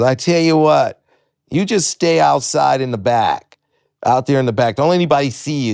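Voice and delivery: imitated club owner. Club owner to underage comic, bossy instructions. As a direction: lay down the rules, word by word.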